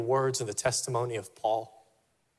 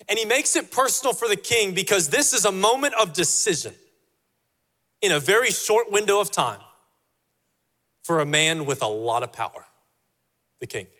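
ordinary talk, nothing marked

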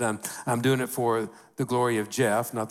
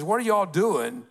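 raised, high call across the quarry; 7 semitones higher than usual. Retold or imitated. Man calling to quarry workers, high raised call. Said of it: "he calls out to them".

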